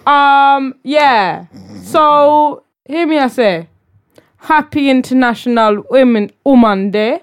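a hammed-up, badly faked Jamaican accent; a lightly voiced, badly done Jamaican patter. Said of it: "the worst Jamaican accent of my life".

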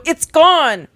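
high, panicky read-aloud screech. A narrator's reading. Child pointing out the window at a missing grave, high screech. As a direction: screech it upward in alarm. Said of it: "you screech".

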